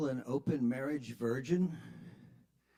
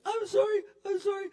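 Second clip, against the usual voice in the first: much higher, whiny mock pleading; whimpering apology; faster than usual. Pinned driver begging the cyclist, high whiny pleading. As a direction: squeal out the apology. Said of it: "he's like".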